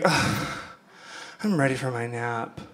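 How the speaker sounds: groan, then a low, soft tired voice; mock weary mom. Comic imitating her mom wanting a Sunday nap, weary. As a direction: imitate a worn-out mom winding down.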